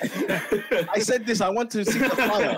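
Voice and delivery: put-on laughing, high Italian-accented Conte voice; mock Conte Italian accent. Friends cracking up as he mimics Conte, laughing in an Italian accent. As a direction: ham up a thick Italian accent through laughter. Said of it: "you said that all with a Conte Italian accent".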